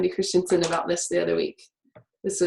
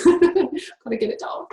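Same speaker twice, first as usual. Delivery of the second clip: laughing; 8 semitones higher than usual. Real, not acted. Laughs while urging note-taking.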